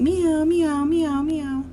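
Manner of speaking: high, drawn cat meows; meowing cat. Acted. Teacher voices pet store cat for children, high meowing. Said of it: "and the cat saying".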